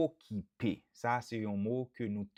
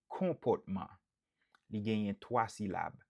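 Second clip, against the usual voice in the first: ordinary talk, nothing marked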